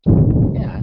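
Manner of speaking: usual speaking voice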